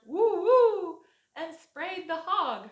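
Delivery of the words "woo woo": high loud barking woo; hammed-up dog bark. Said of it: "who barked".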